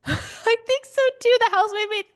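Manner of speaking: normal talking voice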